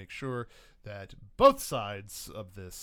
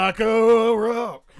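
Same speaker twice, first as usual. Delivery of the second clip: announcer shout; 10 semitones higher, 20 dB louder, much slower than usual. Acted.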